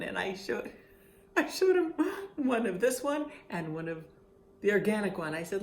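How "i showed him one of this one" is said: laughing out the words, much higher. Real, not acted. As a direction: break into a high laugh. Laughing at the memory.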